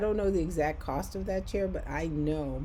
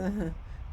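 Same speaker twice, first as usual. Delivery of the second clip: brief, higher, put-on chuckle; polite little laugh; 4 semitones higher, 3 dB quieter than usual. Humouring the strange man at the meeting, polite laugh. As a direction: laugh nicely to humour someone. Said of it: "nicely, you know how you".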